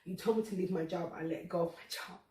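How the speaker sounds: ordinary talk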